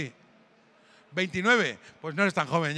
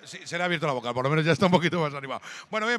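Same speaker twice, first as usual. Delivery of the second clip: ordinary talk, nothing marked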